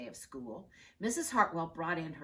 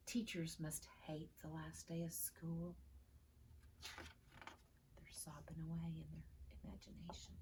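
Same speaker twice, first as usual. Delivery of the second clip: hushed; 16 dB quieter than usual. A narrator's reading.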